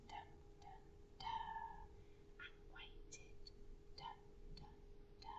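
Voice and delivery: faint whispered dun dun dun; breathy drumbeat of waiting; whispered suspense. Shown as a demonstration.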